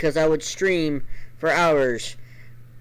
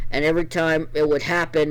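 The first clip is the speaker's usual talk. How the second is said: ordinary delivery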